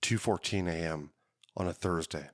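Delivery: hard-boiled detective voice-over, measured and moody; noir narration. Acted. Podcast host opening his cold case like a noir detective. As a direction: tell it like a tired private eye.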